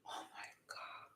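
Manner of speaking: breathless whisper. Real, not acted. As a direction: barely voice it, despairing. Despairing at the bathing suit.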